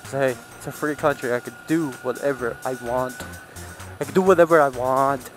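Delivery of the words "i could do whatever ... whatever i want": normal talking voice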